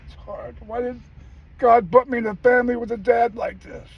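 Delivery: put-on high, hurt boy's voice begging his mom; child pleading. A boy asking his mom why his dad is like this, high and hurt.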